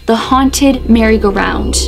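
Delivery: usual speaking voice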